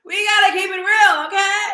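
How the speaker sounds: high, loud rallying call; emphatic shout. Real, not acted. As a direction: shout it out to the ladies. Emphatic rallying cry to viewers.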